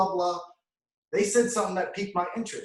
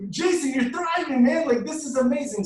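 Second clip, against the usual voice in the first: higher, louder cheering as his friends; excited friends; 6 semitones higher, 3 dB louder than usual. Retold or imitated. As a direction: cheer him on like laughing friends. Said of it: "They're like".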